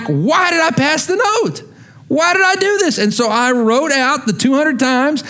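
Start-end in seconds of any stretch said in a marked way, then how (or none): 0.0-3.0 much higher, incredulous self-questioning; exasperated kid. Retold or imitated. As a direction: whine it up high in disbelief. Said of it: "I'm like".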